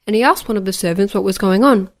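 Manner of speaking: usual speaking voice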